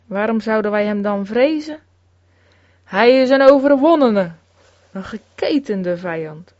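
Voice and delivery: usual speaking voice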